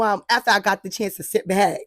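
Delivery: ordinary delivery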